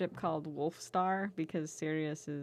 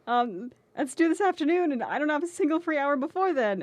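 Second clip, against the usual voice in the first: excited schoolboy; 8 semitones higher, 5 dB louder than usual. Acted. Sirius voiced in fanfic reading.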